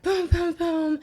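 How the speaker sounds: high, loud booms; imitated door pounding. Mimicking mom's third knock, imitated pounding. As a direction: mimic an impatient fist on the door. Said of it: "this time she is not happy".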